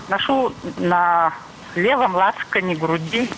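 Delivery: ordinary talk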